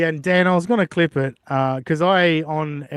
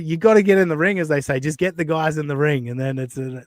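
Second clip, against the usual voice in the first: usual speaking voice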